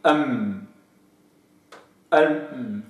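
أم with a held humming m; m demo. Shown as a demonstration. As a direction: hum the m in أم gently. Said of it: "فالظاهر من الشفة أقل".

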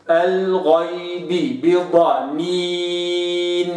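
slow الغيب بضنين, lips sealed; slow tajweed reading; lips closed. Shown as a demonstration. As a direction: recite الغيب بضنين slowly. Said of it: "هكذا".

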